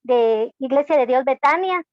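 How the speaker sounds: ordinary delivery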